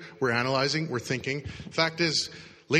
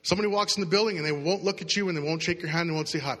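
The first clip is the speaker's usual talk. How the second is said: ordinary talk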